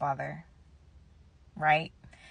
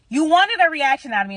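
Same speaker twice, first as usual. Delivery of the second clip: indignant callout; 7 semitones higher, 7 dB louder than usual. Real, not acted. Confronting father's cruel remark.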